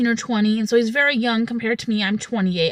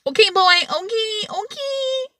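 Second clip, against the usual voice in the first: climbing into a tiny high squeak; squeaky mock okay; 9 semitones higher, slower than usual. Acted reaction to his flirting.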